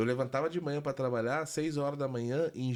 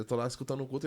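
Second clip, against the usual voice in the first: normal talking voice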